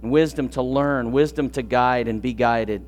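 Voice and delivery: soft, low prayer voice; hushed closing prayer over music. Acted. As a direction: pray it quietly and humbly.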